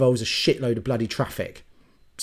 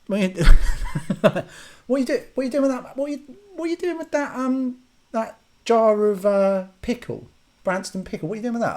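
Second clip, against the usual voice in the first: much higher, fast incredulous quizzing; hammed-up confusion about the jar; mock baffled questioning; 9 semitones higher than usual. Mock interrogating door ajar user.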